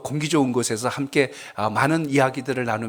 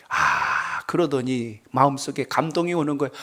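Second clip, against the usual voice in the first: ordinary talk, nothing marked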